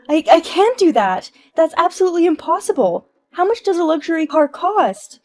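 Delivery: high, loud frantic protest; panicked shout. Acted. Wife panicking about the car bill, shouting. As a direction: cry out in disbelief.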